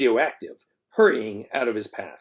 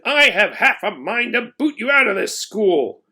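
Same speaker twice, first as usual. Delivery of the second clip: angry principal; 8 semitones higher, 4 dB louder than usual. A narrator's reading. Principal continues the rant.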